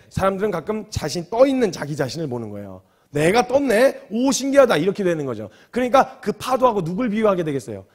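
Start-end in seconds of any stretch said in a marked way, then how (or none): 3.1-4.8 mock amazement, pitched up and louder; amazed Peter. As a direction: gasp at floating on water. Peter notices himself floating.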